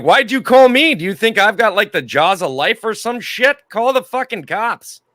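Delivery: loud high exasperated yell. Retold or imitated. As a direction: yell it in disbelief. Retold yelling at the soldiers.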